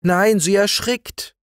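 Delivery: very high, drawn-out cry; high-pitched girl's alarmed protest. A narrator's reading. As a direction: cry out to stop him. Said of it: "schrie Elschen dagegen".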